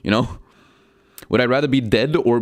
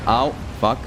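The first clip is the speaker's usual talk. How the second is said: normal talking voice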